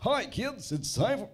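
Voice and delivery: put-on high cheerful kids TV voice; wrong-channel kiddie voice; kids show host. Shown as a demonstration. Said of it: "Sorry, wrong channel".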